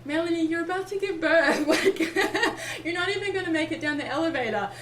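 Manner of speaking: high, loud, laughing through the words; amused midwife. Retold or imitated. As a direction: tease her while cracking up. Midwife laughing at her request.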